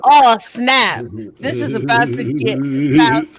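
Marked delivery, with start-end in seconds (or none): 1.4-3.2 deep hype voice. Pretend. Hype voice dropped very low.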